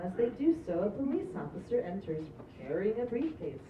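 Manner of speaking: ordinary talk, nothing marked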